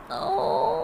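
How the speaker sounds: high drawn-out yawn; a big sleepy "oh" of a yawn. A narrator's reading. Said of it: "Jesus yawned".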